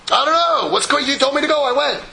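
high, loud, flustered insisting; frazzled patient. Retold or imitated. Stressed patient insists to the doctor, high and flustered.

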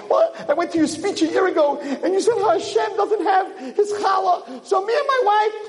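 high, eager, naive telling; eager confession. Acted. Peasant confiding his challah plan to the rabbi, eager. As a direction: spill the secret proudly.

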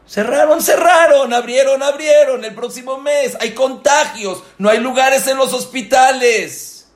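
high, loud alarmed listing; urgent preaching. Acted. Rabbi warning his listeners about the pandemic, loud urgent preaching. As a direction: sound the alarm to the congregation.

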